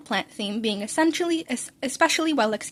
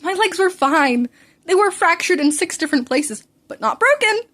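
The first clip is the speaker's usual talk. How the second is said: indignant protest; 6 semitones higher, 5 dB louder than usual. Acted. Protesting injury account.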